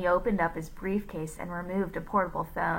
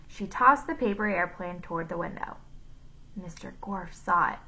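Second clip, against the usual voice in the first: plain reading voice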